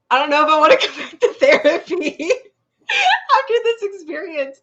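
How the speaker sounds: high, loud, laughing through the words. Retold or imitated. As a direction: crack up mid-sentence while quoting the husband. Punchline of story, breaking into laughter.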